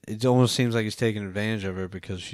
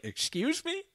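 much higher, incredulous rising; mock outrage. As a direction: act shocked at the director. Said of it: "I was like".

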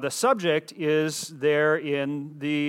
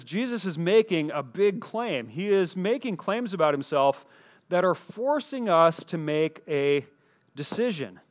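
ordinary talk, nothing marked

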